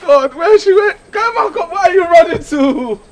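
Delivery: flustered suitor chasing the woman, high loud calls; calling after her. Acted. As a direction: call her back as she runs away. She runs off, he calls.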